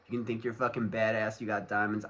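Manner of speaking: ordinary talk, nothing marked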